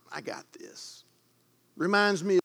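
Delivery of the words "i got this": whispered, breathy mock self-assurance; mock dismissive whisper. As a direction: shrug it off under the breath. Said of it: "They're like".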